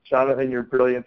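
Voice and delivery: ordinary delivery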